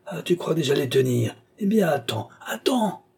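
rising to a high-pitched peak; threatening cry. A narrator's reading. Old man warns his would-be heir, threatening. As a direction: build the threat to a shrill last word.

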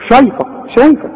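شايفك said with a thin, light sh; the mistaken light ش in شايفك, mocked; wrong thin sh. Shown as a demonstration. Teacher mocks a student's thin ش in شايفك. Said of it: "عمرك ما تقول له".

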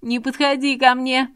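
high, loud shout; a desperate woman screaming at the man approaching. Acted. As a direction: scream at him to stay back. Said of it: "закричала Тамара".

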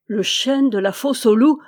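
a loud shocked stammer. A narrator's reading. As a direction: blurt it out, stunned and faltering. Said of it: "balbutia-t-il".

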